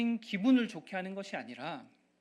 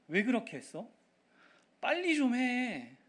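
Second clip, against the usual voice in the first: imitating curt impatience at home, pitch jumping up; retold brusque nagging; 7 semitones higher, 3 dB louder than usual. Husband acting out careless words to his wife at home, brusque. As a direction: act out a snappish demand, stretched and higher at the end. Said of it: "상처 주는 퉁명스러운 말들로".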